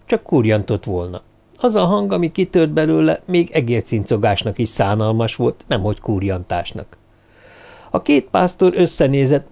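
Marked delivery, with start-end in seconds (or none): none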